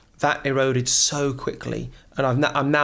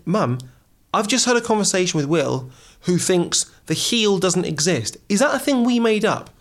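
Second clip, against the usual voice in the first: retold indignation; 6 semitones higher, 4 dB louder than usual. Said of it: "I was livid when I found this out".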